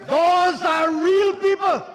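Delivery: rally the benches, voice climbing to a shout; fiery oratory. Real, not acted. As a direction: minister thundering at the opposition benches, full-force fiery speech. Fiery parliamentary oration, shouting.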